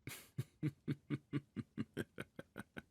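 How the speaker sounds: a pleased, knowing laugh; amused laugh. Acted. Emperor laughing at his frightened pet, amused. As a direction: laugh fondly at her fear.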